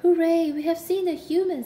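high, loud hooray; cheering. A narrator's reading. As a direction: cheer in triumph. Triumphant cheer.

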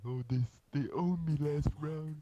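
slow, whiny fake sob voice; hammed-up blubbering, mocking a sore loser; mock crying. Teasing a friend who lost the round, mock crying. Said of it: "Daniel's crying".

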